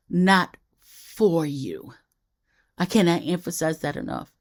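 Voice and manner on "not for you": "not" drawn out, very slow and deliberate; slow emphatic not. Shown as a demonstration. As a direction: let "not for you" land slowly. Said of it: "Let me emphasize that again".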